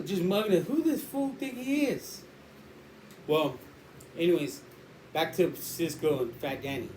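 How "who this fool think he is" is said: higher-pitched, drawn-out disbelieving question; mock challenge. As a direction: size up a cocky stranger. Acting out sizing someone up.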